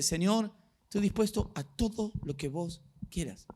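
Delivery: usual speaking voice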